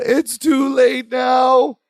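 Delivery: high, drawn-out wail, imitated; hammed-up sobbing cry; mock sobbing. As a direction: act out a slow tearful lament. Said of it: "Balki again sobs".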